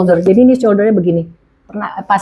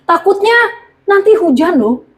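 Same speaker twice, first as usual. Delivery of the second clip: worried voice; 8 semitones higher than usual. Retold or imitated. Mimicking fearful everyday talk.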